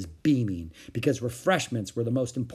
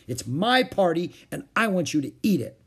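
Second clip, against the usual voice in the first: put-on higher, mildly angry child; bossy insistence; 6 semitones higher, 4 dB louder than usual. A narrator's reading. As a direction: insist like an indignant hostess. Ramona insists in quarrel.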